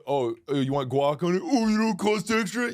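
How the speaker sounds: very high, mocking server; snooty cashier voice. Mocking the Chipotle worker upselling guac, high. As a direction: sneer like a smug employee. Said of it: "I'm gonna go".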